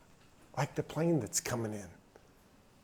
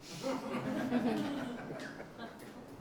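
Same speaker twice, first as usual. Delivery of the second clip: laugh; 11 semitones higher, 9 dB quieter than usual. Real, not acted. Laugh after dad's punchline.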